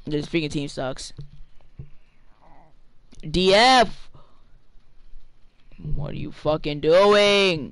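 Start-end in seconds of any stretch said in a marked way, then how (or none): 3.3-4.1 loud, higher yell; shouted call. Real, not acted. Gamer yelling at his team to play defense, loud call. 5.9-7.7 slow, loud, drawn-out disbelief; exasperated rage. Real, not acted. Gamer demanding to know what his teammate is doing, slow angry disbelief.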